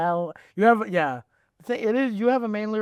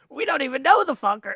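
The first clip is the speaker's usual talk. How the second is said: high, louder mock-exasperated dad; dad butting in; 7 semitones higher, 4 dB louder than usual. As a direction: burst in and debunk it as dad. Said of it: "your dad just comes in".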